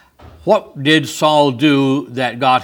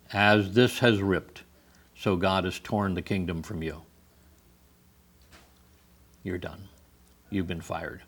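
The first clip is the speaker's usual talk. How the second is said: quiet verdict; 5 semitones lower, 9 dB quieter than usual. Acted. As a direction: let the sentence sink in quietly. Samuel pronounces judgment on Saul.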